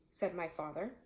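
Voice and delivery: usual speaking voice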